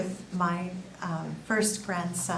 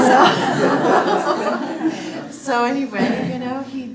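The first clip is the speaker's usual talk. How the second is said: a loud, high laugh spilling into words; 5 semitones higher, 14 dB louder, much slower than usual. Real, not acted. Grandmother laughing at the doctor's convert joke, loud laughter. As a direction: laugh loudly, then carry on.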